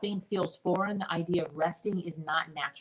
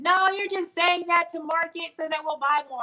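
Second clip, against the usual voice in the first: much higher, louder sneering skeptic voice; mocking the skeptics; 11 semitones higher, 6 dB louder than usual. Mimicking audience accusing her of marketing hype, high mocking voice. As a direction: mimic doubters accusing you of a sales trick. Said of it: "They said".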